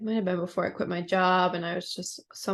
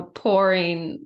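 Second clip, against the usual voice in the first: ordinary talk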